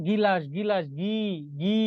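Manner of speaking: "গিলাস" slowly, then just "গি" held long; teacher stretching "গি" out of "গিলাস"; drawn-out first syllable. Shown as a demonstration. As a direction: isolate the g plus i at the start of "গিলাস". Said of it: "আগে গর উচ্চারণ".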